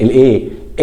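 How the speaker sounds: ordinary talk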